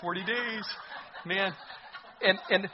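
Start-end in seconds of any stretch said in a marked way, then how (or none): 0.0-1.5 high, slow, soft gloat with a laugh; gloating under his breath. Retold or imitated. Jonah muttering to himself he can't wait, gloating. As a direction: relish the doom quietly, chuckling. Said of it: "under his breath".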